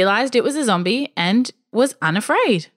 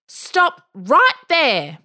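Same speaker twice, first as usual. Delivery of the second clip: bossy command; 6 semitones higher, much slower than usual. Acted. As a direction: halt the zombies like a bossy kid. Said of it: "her boldest "do as I say" voice".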